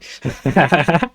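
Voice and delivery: short burst of loud laughter. Real, not acted. Streamer cracking up at own remark, loud laugh.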